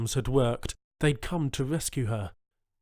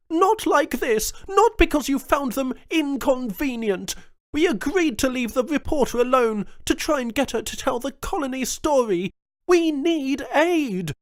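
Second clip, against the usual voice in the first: furious governor; 13 semitones higher, 7 dB louder than usual. A narrator's reading. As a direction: plead hard through anger. Jones furious at cousin.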